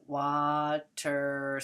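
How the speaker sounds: stretched out as wa-ter, each syllable apart; slow, split into syllables. Shown as a demonstration. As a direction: say water slowly, syllable by syllable. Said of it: "say it fast".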